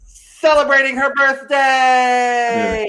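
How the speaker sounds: slow, high, drawn-out cheer; celebratory shout. Pretend. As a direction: holler it like a big announcement, stretched out. Announces birthday after set-up question.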